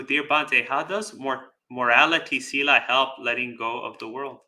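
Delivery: ordinary delivery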